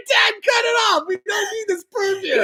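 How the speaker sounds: very high, cracking-up yell at dad; hammed-up high-pitched protest; mock exasperated shout. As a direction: shout down your dad for laughs. Voicing exasperated child shouting at dad.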